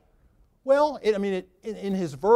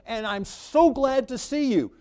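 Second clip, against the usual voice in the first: higher, overjoyed greeting to long-lost brothers; gushing welcome; 7 semitones higher, 4 dB louder than usual. Acted. Joseph warmly greeting the brothers who sold him, gushing. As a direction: pour it out like a delighted host. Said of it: "The opposite to that would have been".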